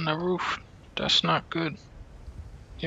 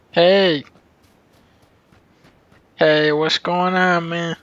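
loud, slow friendly hail; calling out; 7 dB louder, slower than usual. Real, not acted. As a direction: call out across the map. Calling out to another player.

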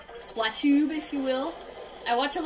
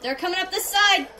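raised, louder warning; higher, urgent shout; urgent callout; 5 semitones higher, 5 dB louder than usual. Real, not acted. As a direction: call out to teammates mid-battle. Calling out enemy position.

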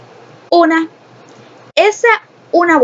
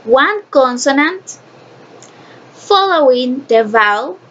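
slow, spaced-out dictation of a rule; slow rule reading; slower than usual. Shown as a demonstration. Teacher reciting the English rule to the class, slowly and deliberately. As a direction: read it out slowly in short chunks.

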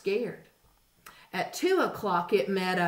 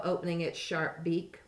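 plain reading voice

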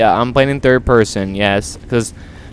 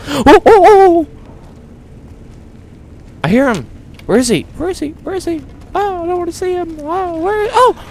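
high panicked voice; 10 semitones higher than usual. Acted. Panicking at invisible enemy.

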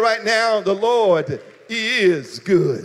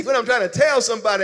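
ordinary talk

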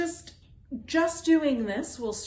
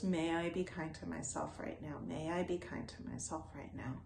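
softer, fading into a near-murmur; soft repetition; 8 dB quieter, faster than usual. Shown as a demonstration. Teacher demonstrating the mantra repeated to oneself, softly fading. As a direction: repeat "May I be kind to myself right now" softer each time, turning inward. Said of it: "And you might just repeat those".

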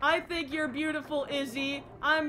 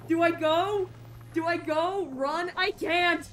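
scared; 5 semitones higher, 5 dB louder, slower than usual. Real, not acted. Scared to move on in game.

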